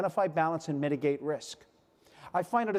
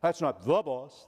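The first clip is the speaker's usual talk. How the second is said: slow That's not the boss, leaning on the; slow and deliberate, the word the underlined; stress on the; 4 semitones lower, slower than usual. Shown as a demonstration. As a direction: spell out the difference slowly. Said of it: "Notice that's not my boss".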